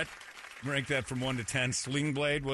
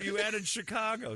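ordinary delivery

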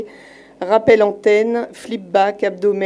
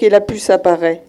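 ordinary delivery, nothing marked

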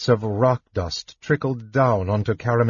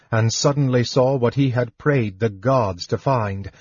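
usual speaking voice

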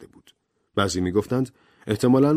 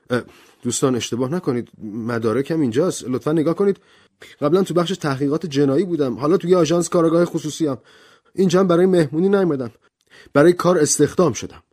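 a raised, fast voice for Blore; hurried detective pleading his case; Blore voiced; 8 semitones higher, 4 dB louder, faster than usual. Detective insisting to the guests he's here for work, hurried.

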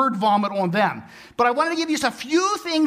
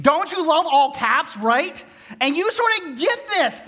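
normal talking voice

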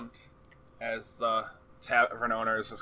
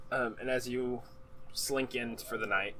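ordinary talk

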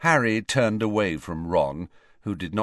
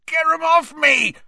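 much higher, much louder panicked yell; 8 dB louder than usual. A narrator's reading. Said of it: "he yelled".

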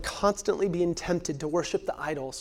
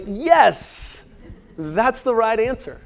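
proud answer; 5 semitones higher, 6 dB louder, slower than usual. Retold or imitated. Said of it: "And I'm like".

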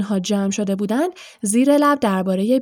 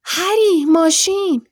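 higher and louder, a burst of discovery; sudden excitement; 8 semitones higher, 5 dB louder, slower than usual. A narrator's reading. Ron, eyes lighting up, cries out to Harry excitedly. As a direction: blurt out the idea with a spark. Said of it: "چشمهای رون برقی زد".

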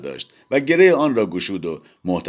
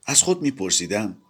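ordinary delivery

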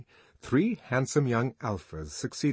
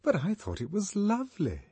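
high, bewildered woman voice; genuinely astonished; 11 semitones higher than usual. Acted. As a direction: sound truly surprised. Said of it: "Lenina was genuinely astonished".

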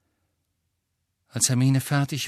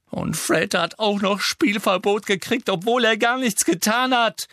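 higher and louder, furious protest; indignant, heated complaint; angry outburst; 12 semitones higher, 6 dB louder than usual. Acted. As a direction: rage at the unfairness. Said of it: "sagte Alicia wütend".

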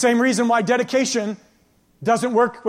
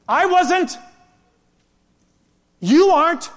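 higher, louder, a short preached punch; emphatic declaration; 7 semitones higher, 5 dB louder, slower than usual. Acted. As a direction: call them out, each word struck. Preacher confronting the congregation.

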